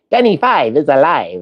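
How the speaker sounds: a hint of excited robot; robot catchphrase. Quoting Johnny Five's catchphrase to viewers, light mock robot voice.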